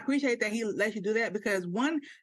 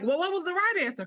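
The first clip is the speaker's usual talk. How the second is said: indignant question; 10 semitones higher, 3 dB louder than usual. Retold or imitated.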